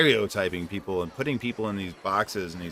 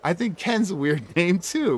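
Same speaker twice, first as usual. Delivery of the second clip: higher, slow words through laughter; laughing; 8 semitones higher than usual. Real, not acted. Telling a friend her name is fine, laughing. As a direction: let the laugh spill over the words.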